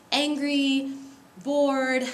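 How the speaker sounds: ordinary talk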